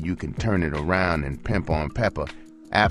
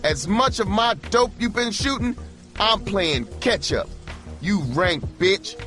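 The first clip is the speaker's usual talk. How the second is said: threatening scream. A narrator's reading. Pimp screams threats after his departing hooker. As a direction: threaten her with raw rage. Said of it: "He screamed".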